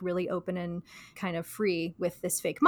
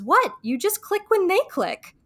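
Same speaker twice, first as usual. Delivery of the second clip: high, surprised mock-Rachel, "click" tossed off lightly; matter-of-fact Rachel; 10 semitones higher than usual. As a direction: say it high like it's totally obvious. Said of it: "she goes".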